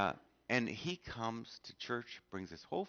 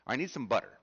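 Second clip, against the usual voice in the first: blunt, pushy request, a bit louder; assertive demand; 4 semitones higher, 6 dB louder, faster than usual. Retold or imitated. Neighbor woman demanding butter from Holly, pushy. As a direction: demand it, no please. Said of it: "kind of about that tone".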